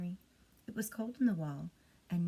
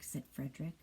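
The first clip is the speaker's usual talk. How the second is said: ordinary delivery, nothing marked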